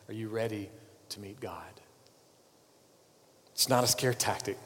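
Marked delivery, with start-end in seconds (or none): 0.1-1.8 "Are you ready" softly, then "to meet God" whispered; hushed repeat. Shown as a demonstration. Preacher repeating his question to the congregation, fading into a whisper. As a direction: drop the repeat to a whisper so it sinks in. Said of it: "I'll say it again".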